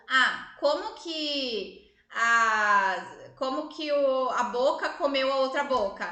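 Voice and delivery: mother lightly voicing her four-year-old's riddle; imitating her daughter. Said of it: "ela me faz pergunta tipo".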